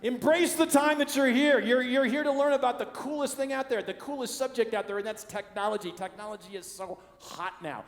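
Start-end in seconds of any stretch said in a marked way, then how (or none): 0.0-3.4 shouted rally. Acted. Rallying the crowd.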